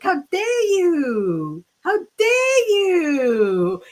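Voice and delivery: high, long-drawn indignant wail; hammed-up affront, stretching the words out; mock outrage. Client retelling her reply to the psychic, hammed-up outrage.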